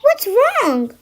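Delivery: confused little mouse. Acted. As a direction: ask like a friendly, baffled mouse. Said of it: "He was so soft and confused".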